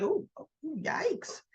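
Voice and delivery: imitating an awkward backing off; a touch of sheepish retreat, slow and high; mock awkward Jack. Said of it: "And Jack's like".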